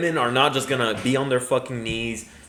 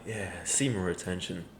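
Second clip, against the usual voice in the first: softer, lower smug murmur; hushed smug creep voice; 6 semitones lower, 10 dB quieter than usual. Retold or imitated. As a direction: purr it like a self-satisfied creep. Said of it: "And you're like".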